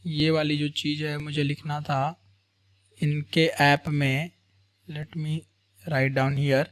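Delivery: low self-directed aside with gaps; talking to oneself. Real, not acted. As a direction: think aloud quietly as you write. Talking to self while writing.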